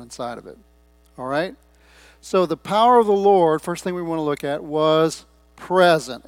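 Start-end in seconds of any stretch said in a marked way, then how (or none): 4.6-6.2 "present" set apart and hit hard, loud; slow down and land heavily on "present"; stress on PRESENT. Real, not acted. Preacher underlining a key word of scripture, emphatic stress on "present". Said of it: "Emphasis on the word present".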